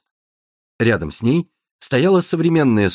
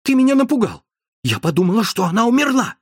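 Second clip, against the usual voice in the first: much higher, frightened telling-off; upset scolding; 10 semitones higher, faster than usual. Acted. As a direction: scold him out of leftover fright. Напуганная Амандина бранит коллегу. (испуганно и сердито, высоко)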